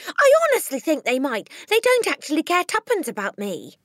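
high, loud excited cry; cried. A narrator's reading. Matilda cries out to Miss Honey excitedly. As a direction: burst out hopefully. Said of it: "Matilda cried".

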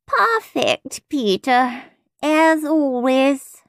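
high, slow, drawn-out sigh of approval; sighing teacher. A narrator's reading. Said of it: "Miss Tutu sighed".

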